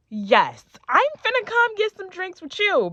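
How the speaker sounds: loud, high, emphatic acceptance; eager yes. Retold or imitated. Girlfriend accepting drinks from the other boyfriend, loud eager agreement.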